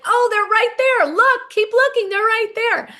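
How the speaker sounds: high, eager coaxing voice; excited high-pitched urging. Retold or imitated. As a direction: coax her to keep looking. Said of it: "and everybody's like".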